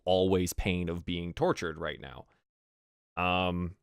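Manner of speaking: ordinary delivery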